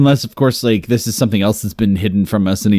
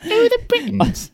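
Monty Python quote; 4 dB quieter than usual. Retold or imitated.

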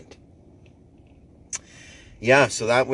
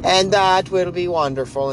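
usual speaking voice

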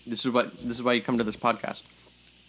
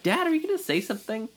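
daughter's plea; 14 semitones higher, faster than usual. Retold or imitated. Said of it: "their daughter, is like".

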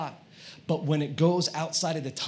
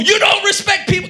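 high, loud angry shout; furious mock rant at full volume; abusive shouting; 10 dB louder than usual. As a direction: yell it as a hammed-up complaint. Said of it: "I am abusing the person".

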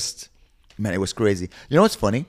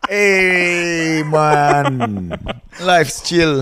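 put-on laid-back Jamaican accent, raised and louder; 8 semitones higher, 3 dB louder, much slower than usual. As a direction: chill Jamaican drawl, laughing through it. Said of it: "one of my favorite accents".

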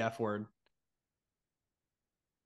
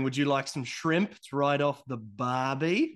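slow, stretched Aussie cliché; mock Australian accent; slower than usual. Waiter offering shrimp in a slow mock Australian accent. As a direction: put on a drawn-out Australian waiter voice. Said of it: "And did he say".

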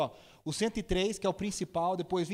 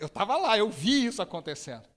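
usual speaking voice

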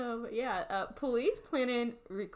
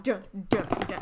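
dramatic dun dun dun; 4 dB louder than usual. Pretend. Dramatic sting sound.

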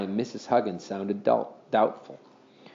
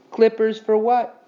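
put-on boy voice; 9 semitones higher, 5 dB louder than usual. A narrator's reading. Henry's voiced question.

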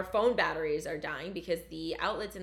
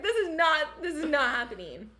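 laughing refusal; 9 semitones higher, 6 dB louder than usual. Retold or imitated. Quoting own refusal, laughing.

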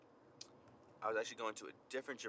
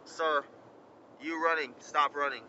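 stern officer command; 4 semitones higher, 8 dB louder than usual. Retold or imitated.

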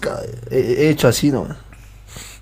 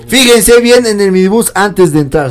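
laughing warning; 6 semitones higher, 9 dB louder than usual. Real, not acted.

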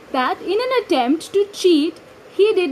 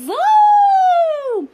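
high loud whoosh of a sled; high, loud zoom; zooming sledge sound; 12 semitones higher, 6 dB louder than usual. Acted. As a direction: shout the zoom like a sled flying past. Sledging sound effect in story.

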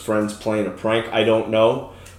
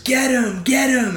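high-pitched mock battle cry of children; imitated kids' yell; 13 semitones higher, slower than usual. Kids charging at a man in the pool, imitated high-pitched yell. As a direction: mimic excited kids calling a charge.

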